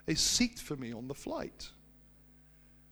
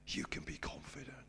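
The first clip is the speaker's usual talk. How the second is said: hushed whisper; 2 semitones lower, 10 dB quieter than usual. Acted. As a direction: whisper it, much softer. Sermon climax, hushed.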